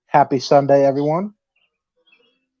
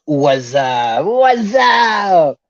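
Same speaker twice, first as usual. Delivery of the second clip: drawn-out wassup; 5 dB louder, much slower than usual. Acted. Podcaster reading a fan's greeting aloud, hammed-up rising holler. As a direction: ham up a long wassup, rising into a yell.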